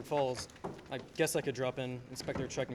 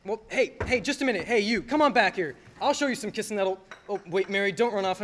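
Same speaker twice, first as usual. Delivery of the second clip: calling out; 4 semitones higher, 7 dB louder than usual. Acted. George calls after old man, Mary.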